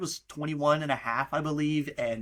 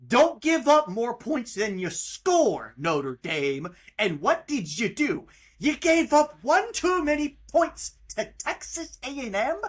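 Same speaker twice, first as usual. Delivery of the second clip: slow heated rant; 6 semitones higher, 4 dB louder, slower than usual. Real, not acted. Ranting at Notre Dame defense.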